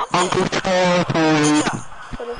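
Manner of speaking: slow, drawn-out put-on voice; silly catchphrase voice. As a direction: stretch the nickname out in a silly voice. Performs the silly catchphrase again.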